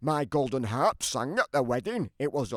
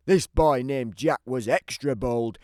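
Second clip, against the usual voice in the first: plain reading voice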